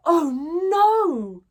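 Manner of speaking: ordinary delivery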